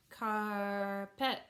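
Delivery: "carpet" in two drawn-out beats, car-pet; syllable by syllable. Shown as a demonstration. Teacher clapping two syllables, "carpet" split car-pet. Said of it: "that has two syllables".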